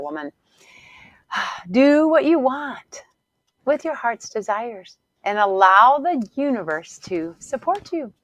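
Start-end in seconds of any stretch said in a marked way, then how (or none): 1.7-8.1 slow, lifted, heartfelt delivery; soulful message. Real, not acted. Coach passing on her client's message, slow and heartfelt. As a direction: deliver it like a revelation.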